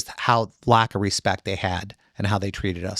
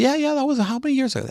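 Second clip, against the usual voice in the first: mock reply voice; faster than usual. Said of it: "And I go".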